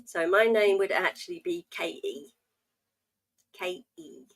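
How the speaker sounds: "K E" said with the T left out; dropped T name. Shown as a demonstration. Teaching a viewer to drop the T in her name. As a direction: say "K E", dropping the T entirely. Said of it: "not allowed to say your T's or your H's".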